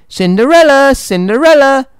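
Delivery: high, calling shout. A narrator's reading. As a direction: call out for someone downstairs. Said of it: "her stepmother shouted".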